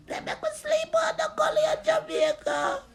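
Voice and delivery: high, strained crying shout; wailing cry. Real, not acted. Ranting vlogger wails about sleeping in the gully. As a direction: cry the words out in anguish.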